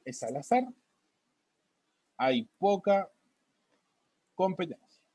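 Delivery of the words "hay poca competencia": long pauses between words, each one spaced out. Real, not acted. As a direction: say it as you write it down. Teacher dictating while writing on board.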